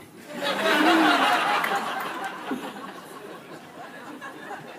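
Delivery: loud burst of real laughter; real hearty laugh; big laugh. Real, not acted. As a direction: crack up at yourself. Laughs at own intro.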